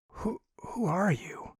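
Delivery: breathless, trembling whisper; frightened whisper. Acted. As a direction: whisper it in terror.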